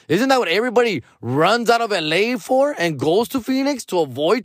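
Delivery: high, heated comic rant; mock-outraged rant about Phoenix traffic; worked-up rant. Real, not acted. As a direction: vent the disbelief, pitch up. Ranting at the friend.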